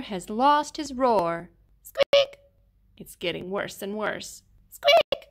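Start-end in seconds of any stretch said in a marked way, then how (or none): 1.8-2.4 sudden high squeaky peep; tiny squeak. Acted. Allosaur trying to roar, a tiny high squeak. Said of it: "roars a tiny squeak". 4.7-5.3 sudden high squeaky peep; tiny squeak. Acted. Allosaur trying to roar, a tiny high squeak. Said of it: "roars a tiny squeak".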